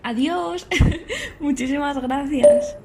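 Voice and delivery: high, laughing farewell called out; cheerful goodbye. Acted. Eva leaving the institute thanks the staff, cheerful and laughing. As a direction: call out happy thanks, loud and high, with a laugh.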